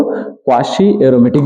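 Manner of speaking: ordinary talk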